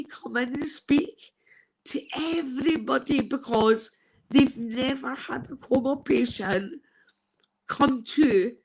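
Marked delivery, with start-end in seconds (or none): none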